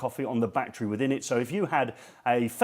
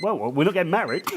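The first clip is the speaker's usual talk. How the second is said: normal talking voice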